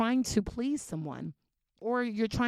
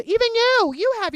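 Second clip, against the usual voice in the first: emphatic call-out; 11 semitones higher, 10 dB louder than usual. Real, not acted. Pointing at the viewer.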